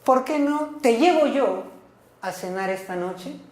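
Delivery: a reproach stressing "llevo"; reproachful question. Shown as a demonstration. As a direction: complain with "¿Por qué no te llevo yo", then trail off on "a cenar esta noche". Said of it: "pero cambiando la entonación".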